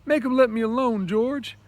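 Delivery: high, loud terrified cry for help; frightened cry. Acted. Lenny calls to George while beaten, frightened cry. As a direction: cry out like a scared child. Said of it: "Lenny gave a cry of terror".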